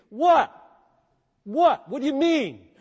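high, loud bewildered what; baffled what. Acted. Preacher voices a baffled listener, high and loud. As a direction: throw up your hands, confused.

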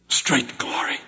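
breathy, hushed; hushed awe. Acted. Preacher marvels at grace, breathed out in awe. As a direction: let it fall to a breath.

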